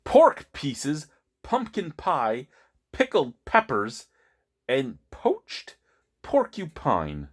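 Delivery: punchy word pairs with pauses; deliberate alliterative list reading; theatrical label read. Acted. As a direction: read the list slowly, relishing each item. Reads alliterative label aloud for effect.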